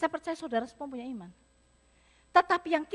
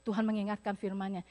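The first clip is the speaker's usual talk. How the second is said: ordinary talk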